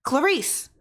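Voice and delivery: high, sudden call across the house. Acted. Husband suddenly calling his wife from his room. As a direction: call her name urgently from his room. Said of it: "suddenly rose and called".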